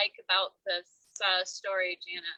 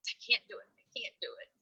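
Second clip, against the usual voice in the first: whispered despair; faster than usual. Retold or imitated. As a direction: whisper it hopelessly. Retelling caterpillar despair.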